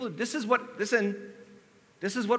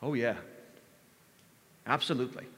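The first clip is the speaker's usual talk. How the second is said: rote memorized line; 3 semitones lower than usual. Retold or imitated. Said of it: "It's this memorized line".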